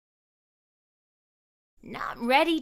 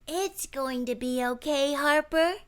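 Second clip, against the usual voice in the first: reassuring; 5 semitones higher than usual. A narrator's reading. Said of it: "she said".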